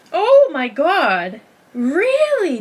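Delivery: loud, high disbelief; oh my God, really, floored; surprised. Real, not acted. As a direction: gasp it out in real shock. Reacting to a surprising article.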